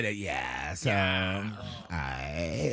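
vocal fry. Acted. Mocking vocal fry topic.

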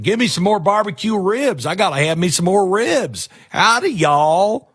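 high, hammed-up Texas drawl; mock a guy suddenly gone all Texan, drawling; fake Texas drawl. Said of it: "where did you get the drawl from".

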